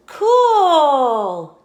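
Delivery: high, loud and happy; a character thrilled; delighted. Acted. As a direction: cheer the new toy. Friend delighted by swap.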